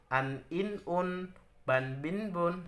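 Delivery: An, in, un said quick and short; fast clipped drill; quick short reading. Shown as a demonstration. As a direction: snap out each syllable. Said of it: "Harus cepat".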